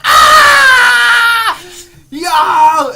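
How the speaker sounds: very loud, high-pitched hysterical scream; screaming laughter. Retold or imitated. Cracking up in class at his friend, screaming with laughter. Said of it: "I'm, like, screaming, laughing, like".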